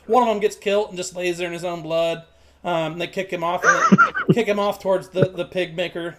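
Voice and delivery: usual speaking voice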